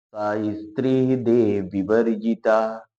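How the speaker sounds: slow recited line, words run together; verse recitation. Shown as a demonstration. Teacher now recites the line in full for students, slowly. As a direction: chant it slowly as part of the shloka. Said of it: "अब हम इस प्रकार से हम पढ़ेंगे".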